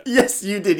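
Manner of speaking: ordinary talk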